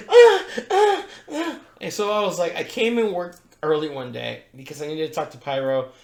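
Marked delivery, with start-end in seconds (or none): 0.1-1.6 high-pitched hammed-up moans into the mic; mock moaning dancer. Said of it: "grab the mic and be like".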